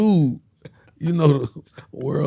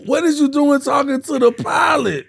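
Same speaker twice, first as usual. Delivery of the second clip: high, loud mock outrage; loud high-pitched demand; retold incredulous shout; 13 semitones higher, 6 dB louder than usual. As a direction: yell it in hammed-up disbelief. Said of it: "I'm like".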